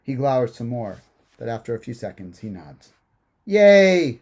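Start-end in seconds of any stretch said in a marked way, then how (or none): 3.4-4.2 high, very loud cheer; gleeful cheer. A narrator's reading. Girl after her brother nods, gleeful cheer. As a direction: burst out with a delighted child's cheer. Girl's brother agrees to play.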